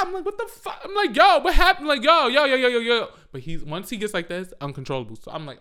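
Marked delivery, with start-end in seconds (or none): 0.2-3.1 high, loud retold alarm; acted panic at a screaming kid; scared. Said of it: "Like, I was scared".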